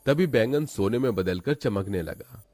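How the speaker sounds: plain reading voice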